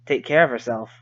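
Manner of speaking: usual speaking voice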